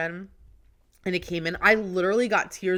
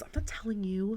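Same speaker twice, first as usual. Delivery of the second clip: soft, breathy whisper; teasing whisper; 3 semitones higher, 5 dB quieter than usual. Real, not acted. Whispering to her co-host that she won't name the vendors. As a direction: whisper it with a tease.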